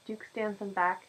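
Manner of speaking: usual speaking voice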